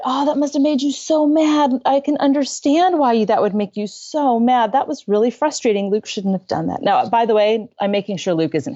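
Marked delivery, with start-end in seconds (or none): 0.0-6.8 gentle understanding voice; empathizing. Retold or imitated. Mom empathizing with angry son, gentle. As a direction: show him you understand. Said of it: "And I said".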